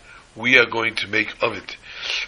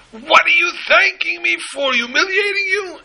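baffled outburst; 6 dB louder than usual. Retold or imitated. Rich man baffled by thanks.

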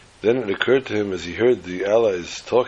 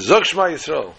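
higher, louder order; commanding shout; 7 semitones higher, 4 dB louder than usual. Retold or imitated. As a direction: demand it sharply, voice up high. Officer commands the prayer.

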